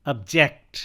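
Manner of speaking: "Object" as ub-JECT, second syllable punched; light start, hit जेक्ट in "object"; stress on second syllable. Shown as a demonstration. Teacher drilling "object" as a verb, stress on जेक्ट. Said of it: "same words को अब यहाँ पे हम verb के रूप में".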